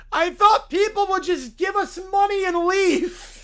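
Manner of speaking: high, shouted skit voice; loud protest. Acted. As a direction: cry out the hopeless excuse. Schemer protests in acted quarrel.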